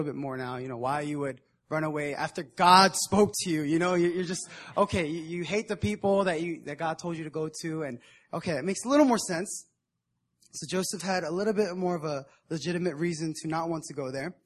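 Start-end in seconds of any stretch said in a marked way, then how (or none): none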